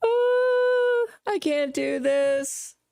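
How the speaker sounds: high wailing fake sob, slowed right down; mock fake crying. Host mocking a defendant's crying, hammed-up wail. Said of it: "Thinks crying is this".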